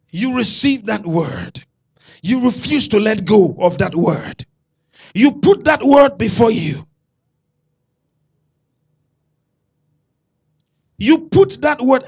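full-force pulpit preaching. Acted. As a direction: preach it out to the congregation.

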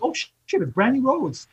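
a jump up in pitch on the first shit, like a startled double take; spotting a celebrity customer up close, pitch leaping in surprise; retold surprise. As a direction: replay the moment you recognised her. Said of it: "but I was, like, surprised".

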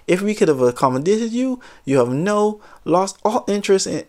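high, fast, prim customer service voice; stern rep telling off. Retold or imitated. Rep orders the rude customer out, mock prim and rapid. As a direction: rattle off the rules like an officious clerk.

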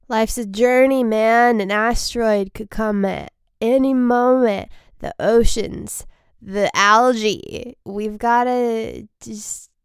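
spacey surfer-guru musing; mock guru. To end the episode, mock hippie guru.

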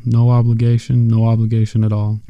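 usual speaking voice

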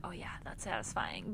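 soft, breathy quoted whisper. Retold or imitated. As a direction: whisper it like a secret thrill. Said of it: "I'm like".

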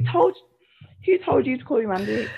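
high-pitched indignant who told you; shrieking in mock disbelief; indignant outrage. Retelling her reply to the cleaner, outraged and high. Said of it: "And I'm like".

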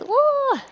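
high, wobbly queasy ooh; mock seasick moan. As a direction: groan like a sick stomach. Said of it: "my stomach was like".

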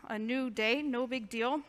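normal talking voice

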